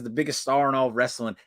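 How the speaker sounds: ordinary talk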